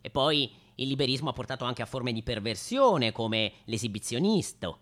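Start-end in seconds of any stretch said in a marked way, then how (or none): none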